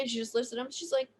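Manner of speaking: usual speaking voice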